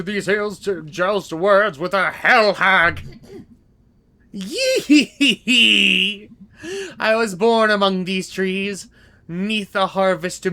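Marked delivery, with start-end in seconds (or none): 4.4-6.3 slow hag cackle; slow witch cackle. Acted. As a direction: cackle slowly like a crone.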